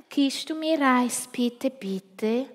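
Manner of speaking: put-on high, slow pleading kid; begging. Christoph begs his cousin for a sweet, pleading. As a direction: beg for a sweet, drawn out. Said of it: "bettelt der Christoph".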